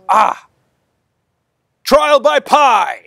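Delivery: very high, loud, slow declaration; absurd proclamation. Acted. Fanatic proclaims trial by pie, very high and slow. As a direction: announce it like a divine revelation.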